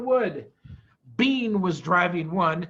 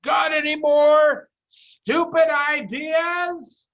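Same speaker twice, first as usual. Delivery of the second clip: slow, loud, high shout; shouting into a deaf man's ear; 8 semitones higher, 8 dB louder, slower than usual. A narrator's reading. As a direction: yell it slowly so the deaf man hears. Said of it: "Bunce shouted back".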